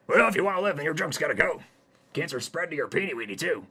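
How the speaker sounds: high, loud, cheery doctor. Acted. Doctor breaks bad news to Hayden, high and loud. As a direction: belt it out as a gleeful doctor.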